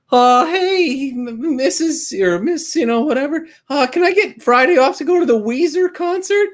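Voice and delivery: very high, put-on pleading voice; imitating male employee. Employee asking manager for Friday off, hammed-up squeaky voice. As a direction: ask timidly in a squeaky falsetto. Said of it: "like, male employee".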